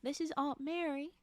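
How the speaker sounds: put-on reading in an American Southern accent. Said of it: "Here comes the Southern".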